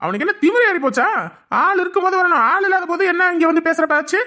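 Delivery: loud, high furious rant; angry outburst. Acted. Husband rages at the man who threatened his wife, loud and furious. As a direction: blast out the outrage. Husband enraged at brother-in-law.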